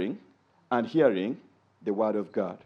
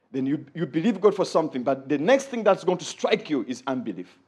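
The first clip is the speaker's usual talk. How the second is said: ordinary talk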